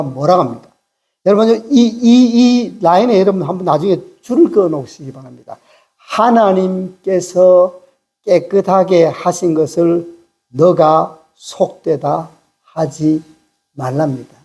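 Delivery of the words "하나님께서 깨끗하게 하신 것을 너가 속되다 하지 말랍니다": slow, low, measured declaration; solemn verse reading. Retold or imitated. Preacher quoting the angel's command to Peter, slow and solemn. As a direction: spell it out phrase by phrase.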